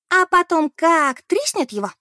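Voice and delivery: high, loud, slow villain voice; menacing like a film villain; horror villain. Acted. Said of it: "как злодей из фильма ужасов". (как злодей из фильма ужасов)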